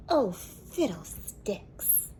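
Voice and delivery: slow, sniffy grumble; sniffing. A narrator's reading. Grandpa grumbles about the nasty weather, slow and sniffy. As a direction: huff it out slowly, unimpressed.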